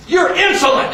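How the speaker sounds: acted indignation; indignant sergeant. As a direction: rebuke him like an affronted sergeant. Sergeant rebukes the laughing soldier.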